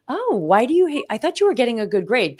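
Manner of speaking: lightly acted, high-voiced disbelief; retold surprise. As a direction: react like a mildly baffled parent. Retold mother puzzled by son.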